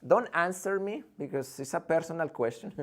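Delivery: usual speaking voice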